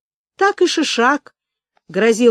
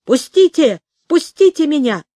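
loud, raised cry to be released; terrified shout; 5 semitones higher, 6 dB louder than usual. A narrator's reading. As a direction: shout it in panic, pitch climbing. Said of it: "закричал он". (испуганный громкий крик)

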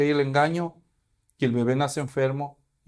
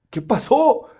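amazed parents; 7 semitones higher, 4 dB louder, slower than usual. Acted. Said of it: "como diciendo".

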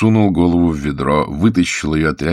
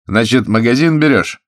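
raised, louder challenge; pressing interrogation; 6 semitones higher, 5 dB louder than usual. Acted. Investigator confronts thief over the shop, pressing. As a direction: push the question at him.

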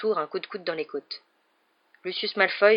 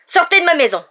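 much higher and louder, roared at the intruder; furious shout; 12 semitones higher, 13 dB louder than usual. A narrator's reading. Hagrid shouts at Malfoy to leave his home, furious roar. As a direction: bellow him out of the house. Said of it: "s'exclama Hagrid avec fureur".

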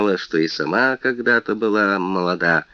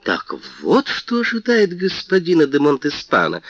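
shocked cry, higher pitch; exclaiming; 8 semitones higher than usual. A narrator's reading. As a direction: cry out in dismay. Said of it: "вскричала Лавальер".

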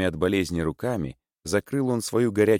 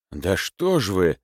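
usual speaking voice

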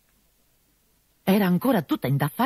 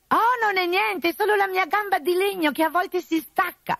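contrite fake bride. Arlecchino excusing the noise to the captain, high and contrite. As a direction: sound solemnly sorry in the high disguise. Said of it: "fece compunto Arlecchino".

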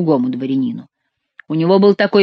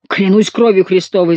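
usual speaking voice

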